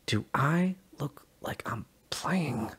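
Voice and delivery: slow, hushed whisper. Acted. Sully to Peng over the comm, slow whisper. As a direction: breathe it, deadly serious.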